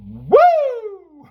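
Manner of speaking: high whooping woo; excited whoop. Acted. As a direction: let out a big excited whoop. Hyped exclamation.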